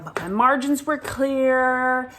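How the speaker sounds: telling viewers the cancer is gone, high and bright; relieved and giddy. Real, not acted. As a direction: rattle off the good news with relief. Relief at clear results.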